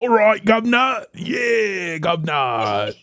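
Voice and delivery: hammed-up London accent, playing a European; a loose cockney-ish British voice for a zombie movie role; mock British accent. Actor auditioning as a Londoner in a zombie film, mock British accent. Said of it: "I wanna get a role where I'm like".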